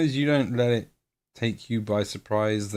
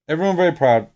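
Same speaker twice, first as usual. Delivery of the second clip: louder and higher, proud; grand declaration; 6 semitones higher, 8 dB louder, faster than usual. Shown as a demonstration. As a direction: announce it to the world. Repeats the line, then bigger.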